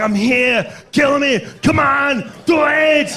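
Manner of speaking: high, strained movie-hero dare; action hero in Predator. Acted. Mud-covered hero challenging the alien, defiant mock shouting. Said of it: "Remember the scene in Predator".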